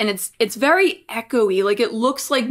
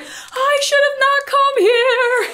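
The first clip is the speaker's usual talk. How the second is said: much higher, slow mock-panicked whimper; mock dread; 14 semitones higher, much slower than usual. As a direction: play your own regret, drawn out high. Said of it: "I'm like".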